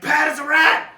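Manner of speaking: sudden high yell of alarm; shouting alarm. Retold or imitated. Retelling how she yelled to Pat, an alarmed shout. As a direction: yell to warn Pat about the rat. Spotting a rat, calling Pat.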